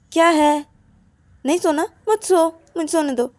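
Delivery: high, irritated snap; annoyed. Acted. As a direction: brush off someone pestering you at bedtime. Acted annoyed reply at bedtime. (चिढ़कर झिड़कते हुए)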